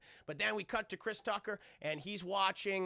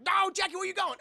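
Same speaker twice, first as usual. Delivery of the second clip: much higher, louder call; Chris Tucker; 12 semitones higher, 7 dB louder, faster than usual. Retold or imitated. Chris Tucker calling after Jackie running off, high loud call. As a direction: shout after him as he runs. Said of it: "And Chris Tucker's like".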